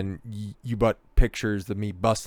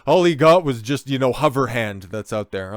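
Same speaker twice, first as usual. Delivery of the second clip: high, louder mocking sneer voice; mocking laughers; 5 semitones higher, 4 dB louder than usual. Vlogger mocks people laughing at the tattoo guy, sneering. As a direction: sneer it like the crowd laughing at him. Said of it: "everybody was laughing at this guy".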